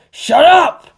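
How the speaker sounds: high, loud furious shout; angry yell. A narrator's reading. Toji snaps at the teasing kids, loud angry shout. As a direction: shut them up with a yell.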